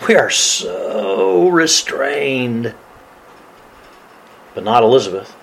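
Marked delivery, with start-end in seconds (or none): 0.1-2.7 very slow, softer, drawn-out SO; restrained. Acted. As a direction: hold back, dragging out the words softly. Acts out the restraint he criticizes.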